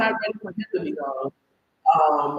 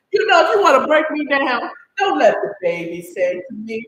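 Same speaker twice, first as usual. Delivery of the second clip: louder, crying through the words; tearful; 4 semitones higher, 5 dB louder than usual. Real, not acted. Moved by the baby's words, tearfully loud. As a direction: let the tears crack through.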